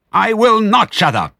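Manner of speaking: very high, loud defiant shriek; piercing, outraged squeal; shrieking rat. A narrator's reading. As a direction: screech your refusal at the top of your voice. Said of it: "shrieked Rat".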